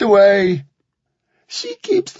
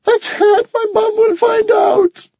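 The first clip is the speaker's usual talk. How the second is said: much higher, louder, sobbing; terrified boy refusing his bully; crying plea; 4 dB louder than usual. Acted. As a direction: cry and shout it in despair. Morgan crying.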